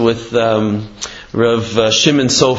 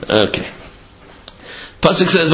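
ordinary delivery

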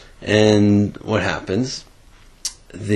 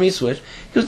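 ordinary talk

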